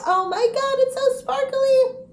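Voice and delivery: very high, loud reenacted squeal; excited squeal. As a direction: squeal it in delight, stretching the end. Re-enacting seeing the engagement ring.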